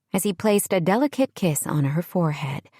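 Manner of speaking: plain reading voice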